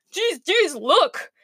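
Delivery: high, swooping panicky plea; panicked. A narrator's reading. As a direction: plead in acted panic. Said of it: "cried the wolf in panic".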